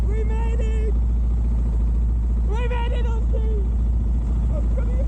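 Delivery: high, joyful shouting; excited cheering. Real, not acted. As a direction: celebrate reaching the top. Reaching the top, celebrating.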